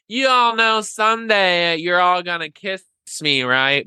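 high, sing-song tipsy brag; cocky party boast. Retold or imitated. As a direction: tease the whole room with a high cocky voice. Drunk party boast to friends.

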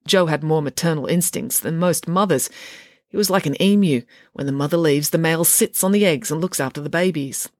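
plain reading voice

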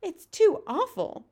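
high, drawn-out cry of dismay; distressed cry. A narrator's reading. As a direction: cry out in horror, pitched high and slowed. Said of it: "Sophie cried".

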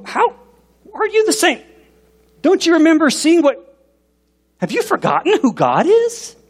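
very high-pitched, exasperated disbelief; incredulous. Acted. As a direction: squeak out mock astonishment at their forgetfulness. Acts disbelief at the Israelites.

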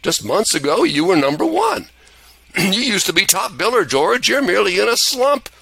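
put-on high, upbeat old-angel voice; an angel cheering up a slumping George; high jolly angel Clarence; encouraging angel.

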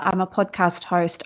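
usual speaking voice

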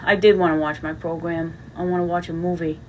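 ordinary talk

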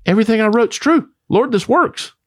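sudden high burst of joy; crying out that it finally works; excited realization. Retold or imitated. Husband telling the Lord his breakthrough, excitedly high. Joyful discovery retold to Lord.

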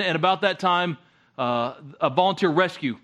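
ordinary talk, nothing marked

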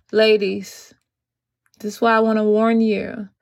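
ordinary talk, nothing marked

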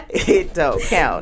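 slow, laughing through the words. Real, not acted. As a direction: giggle out the punchline. Laughing repeat of punchline.

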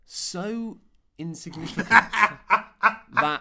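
words breaking into laughter; laughing. Real, not acted. Laughing through the punchline.

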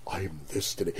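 soft, low mimicry of the man; impression of the customer. Re-showing what the customer did, soft and low. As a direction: drop right down into his hushed line. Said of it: "when he did this".

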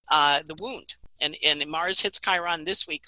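normal talking voice

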